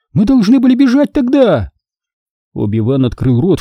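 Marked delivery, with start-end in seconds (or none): 0.1-1.7 high, sustained angry insistence; heated, high-pitched reproach; exploding in anger. A narrator's reading. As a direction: insist hotly, pitch held right up. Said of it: "взорвалась Сири".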